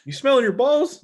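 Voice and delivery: high mocking teacher question.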